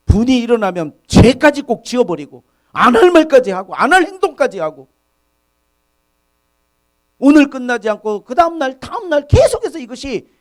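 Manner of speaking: fervent preacher's warning; preaching at full force. Acted. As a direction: warn the flock about anger.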